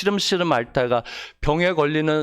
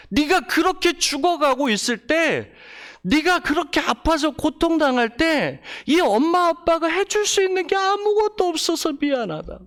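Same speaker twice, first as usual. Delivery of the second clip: wailing grieving parent; 11 semitones higher than usual. Retold or imitated. Grieving mother at the funeral cries out her sorrow to her son. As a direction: lament pitched far higher, voice rising as the grief builds. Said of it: "부모들이 울면서 이렇게 말합니다".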